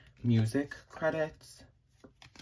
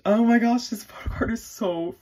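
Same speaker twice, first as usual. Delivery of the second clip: high, loud oh my gosh; excited squeal; 10 semitones higher, 6 dB louder than usual. Real, not acted. Admiring the photo card for viewers, squealing. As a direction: squeal at how pretty it is.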